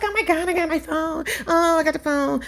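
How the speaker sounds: put-on high, excited girly voice; lightly hammed-up excited woman; mock excited squeal. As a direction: imitate her giddy thrill. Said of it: "She's all excited".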